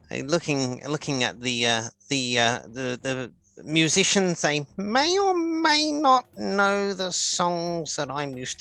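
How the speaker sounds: a lightly voiced rambling old gnome; Grumful the gnome. Old gnome musing to Mira about the town's music.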